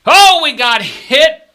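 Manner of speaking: loud, high, slow cry of dismay; stretched-out groan of a loss; dismayed shout. Real, not acted. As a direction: wail it out at the bad roll. Losing roll, crying out.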